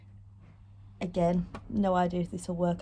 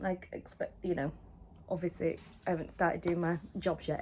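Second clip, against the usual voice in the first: ordinary talk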